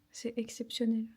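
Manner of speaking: normal talking voice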